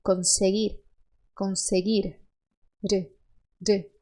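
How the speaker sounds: conseguir and re with a tapped r; tapped final R. Shown as a demonstration. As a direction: tap the r against the palate. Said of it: "a língua sobe e bate".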